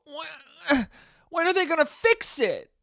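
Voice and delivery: high-pitched helpless complaint; angry whining. Acted. Customer waiting out a power cut, angry whining. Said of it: "You just sit there angry".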